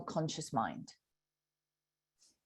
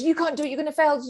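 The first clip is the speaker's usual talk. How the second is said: quick, raised, anxious warning; doubting inner voice; 8 semitones higher, 6 dB louder, faster than usual. Retold or imitated. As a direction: rattle off the doubt fast and louder. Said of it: "that voice goes".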